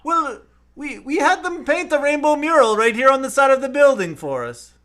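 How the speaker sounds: high, hammed-up Irish leprechaun; Irish accent. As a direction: reassure in a high put-on Irish brogue. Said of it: "do the accent that I can't do".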